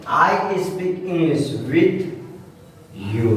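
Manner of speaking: words spaced out slowly while writing; teacher writing a new sentence on the board; slow dictation. Shown as a demonstration. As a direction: dictate it as you write. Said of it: "अब लिखेंगे".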